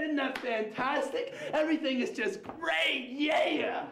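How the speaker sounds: high, drawn-out gushing; gushing enthusiast. Acted. Comic mocking people who think everything's great, high syrupy gushing. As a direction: stretch every word in over-the-top delight. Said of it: "people who thinks everything's great and fantastic all the time".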